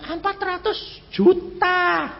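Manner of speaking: slow weighty announcement. Retold or imitated. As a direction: let each word land slowly. Caller names the sum. (mengucapkan jumlahnya pelan dan berat)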